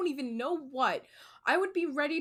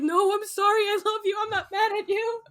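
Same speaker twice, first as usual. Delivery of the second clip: high, louder scared pleading, retold; mock-panicked rush to take it back, pitched way up; panicked apology; 10 semitones higher, 5 dB louder than usual. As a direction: imitate a scared, squealed apology breaking into a laugh. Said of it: "I got so scared".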